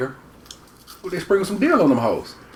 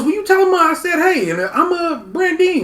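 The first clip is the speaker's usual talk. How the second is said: much higher voice for the lady sending greetings; imitating a friendly local lady; mock lady voice; 13 semitones higher, slower than usual. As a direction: lift way up, sing-song lady voice. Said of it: "He goes".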